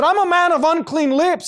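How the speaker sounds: high, loud cry of unworthiness; loud, high-pitched dramatic outcry; anguished confession. Retold or imitated. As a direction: wail it out as a stricken prophet would. Quotes Isaiah's confession before God.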